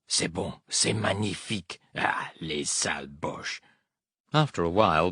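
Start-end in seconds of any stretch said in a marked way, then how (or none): 0.1-3.6 softer, murmured French, under the breath; French muttering. A narrator's reading. A hint of a French helper muttering to the pilot, soft. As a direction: mutter it like a Frenchman on the street at night. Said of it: "The Frenchman began muttering".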